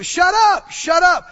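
loud, high shout of shut up; shouted rebuke. Shown as a demonstration. Preacher showing the church how to rebuke doubt, shouting. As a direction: shout them down hard. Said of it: "you need to open your mouth and say".

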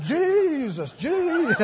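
high, loud drawn-out cry; mock altar cry. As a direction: wail the name out in hammed-up fervor. Mimics frantic altar instruction.

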